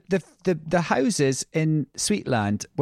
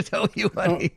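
laughing; 5 semitones higher than usual. Real, not acted. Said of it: "Sorry, I'm just laughing here".

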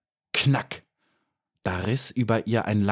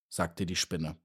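ordinary delivery

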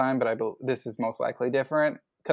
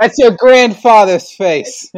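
laughing; 10 semitones higher, 15 dB louder, slower than usual. Real, not acted. Teasing banter, laughing.

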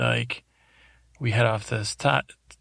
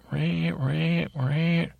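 high, repeated siren-like "Ray"; imitated alarm, pitched up and insistent; red alert; 6 semitones higher, much slower than usual. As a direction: sound the name like an alarm going off. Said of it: "going on red alert".

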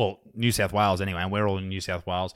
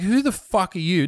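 sharp, louder challenge, higher; retold angry outburst; 8 semitones higher, 3 dB louder than usual. Host retelling his anger at police: indignant outburst. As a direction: fire back at the cop, indignant. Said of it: "I was pretty angry".

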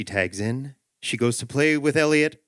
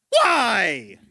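high-pitched mock child's whine; pestering little kid; 8 semitones higher than usual. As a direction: squeal it like a nagging three-year-old. Three-year-old keeps asking why.

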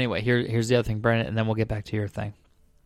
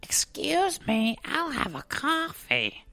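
very high, slow, soft croaky cartoon mom; strained falsetto attempt at a cartoon housewife, drawn out; Marge; 5 dB quieter, much slower than usual. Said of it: "I can't do my Marge lately".

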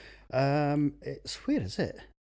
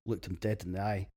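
normal talking voice

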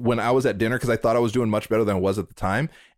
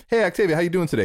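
higher-pitched, breezy hello; chirpy greeting; 6 semitones higher, much faster than usual. Retold or imitated. Acting out asking a friend how she's doing today, chirpy. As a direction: greet them as if for the first time.

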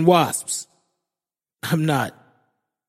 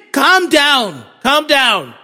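raised, higher-pitched plea; urgent pleading; 6 semitones higher, 4 dB louder, slower than usual. Retold or imitated. Boyfriend to panicking fiancée, urgent raised voice. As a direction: insist over her panic, easing off slightly. Trying to calm panicking girlfriend.

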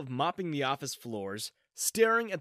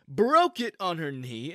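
usual speaking voice

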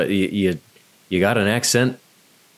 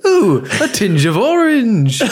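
loud, high, drawn-out mock marvelling; hammed-up delight; 11 semitones higher, 5 dB louder, slower than usual. Co-host riffs on the toilet joke, hammed-up delight. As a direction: coo it slowly, high and over the top.

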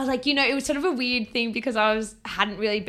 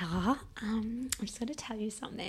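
lowered, half-whispered voice with a hesitant start; hushed, hesitant; 11 dB quieter than usual. Retold or imitated. Friend takes Ella aside to tell her something, hushed and hesitant. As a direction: softly imitate a friend breaking news. Friend breaking bad news, acted.

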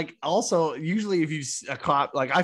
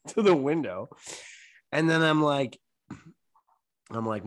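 ordinary talk, nothing marked

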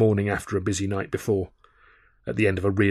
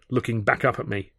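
ordinary delivery, nothing marked

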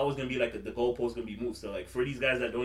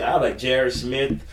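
ordinary delivery

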